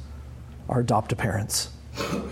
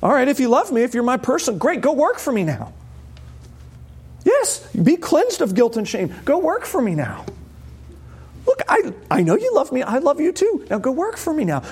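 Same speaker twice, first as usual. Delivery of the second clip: higher, insistent Jesus voice; a lightly voiced, pitched-up Jesus urging them to work; voicing Jesus; 8 semitones higher than usual. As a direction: lift the voice high to play Jesus sending them off. Preacher voices Jesus sending disciples.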